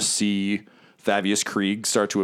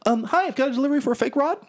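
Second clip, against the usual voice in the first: very high, quick courier voice; squeaky little delivery person; delivery runner; faster than usual. Acted. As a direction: chirp it fast like a nervous errand runner. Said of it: "And it's like".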